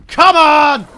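loud, high plea at the game; big exasperated holler; exasperated shout. Real, not acted. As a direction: yell it in sheer frustration. Shot failed, exasperated shout.

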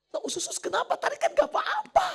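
high, loud incredulous questioning; startled retort. Retold or imitated. Husband questions his wife's sudden stomach ache, startled and high. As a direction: voice shoots up in mock disbelief.

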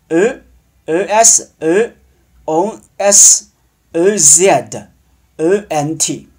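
slow, spaced-out French letter names; teacher reciting endings in French letters; spelling out endings. Shown as a demonstration. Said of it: "后面又加什么呢".

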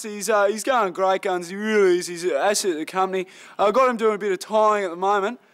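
normal talking voice

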